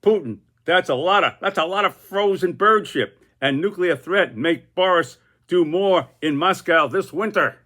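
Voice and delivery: a lightly squawky parrot mocking Putin. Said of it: "Boris the Parrot says".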